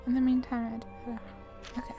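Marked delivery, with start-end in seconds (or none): none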